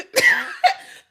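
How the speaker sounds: high, loud burst of laughter; big laugh. Real, not acted. Laughing at her own tearfulness.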